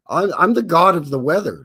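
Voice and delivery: first try at a grand god voice; god of the weather. Shown as a demonstration. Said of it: "No, no, no, hold on".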